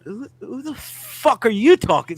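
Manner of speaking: high, loud indignant who the fuck; hammed-up furious retort; retold angry outburst. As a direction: set off, throw it at the clerk high and loud. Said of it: "now you've set me off".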